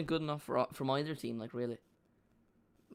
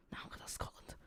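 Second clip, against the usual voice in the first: whispered joke; 13 dB quieter than usual. Real, not acted. Whispered aside.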